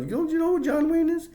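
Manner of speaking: very high-pitched incredulous voice; squeaky put-on astonishment; mock disbelief. Mock shock at not knowing Wayne.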